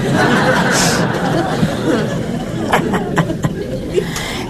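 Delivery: real amused laugh, high and rising; a bright chuckle at the joke. Real, not acted. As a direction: laugh at your own little joke. Laughing at her own joke.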